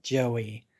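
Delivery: plain reading voice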